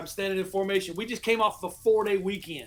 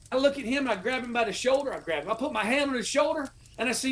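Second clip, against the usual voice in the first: ordinary talk, nothing marked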